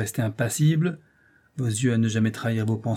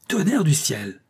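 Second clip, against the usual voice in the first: a higher, cursing outburst; angry oath; 5 semitones higher, slower than usual. A narrator's reading. Mascaro to the doctor, an angry oath. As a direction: swear at the bad news. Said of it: "s'écria-t-il".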